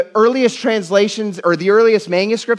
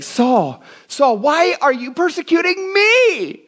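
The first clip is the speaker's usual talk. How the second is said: high, drawn-out wail of indignation; outraged, accusing; 10 semitones higher, slower than usual. Shown as a demonstration. Preacher shows how our flesh reads Jesus' words, high indignant accusation. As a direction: thunder it as a wounded accusation, pitched way up, landing hard on ME. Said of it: "wouldn't we wanna read that like Jesus is saying".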